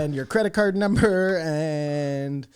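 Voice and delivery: high, drawn-out mock operator voice; mock tech operator. Joking as a phone operator asking for card details, mock helpful. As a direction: stretch it out like a fake helpful scammer.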